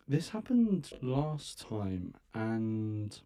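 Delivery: ordinary talk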